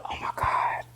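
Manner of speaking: breathy whisper of dismay; shocked whisper. Retold or imitated. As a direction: whisper it, crushed he's leaving. [name] about to leave.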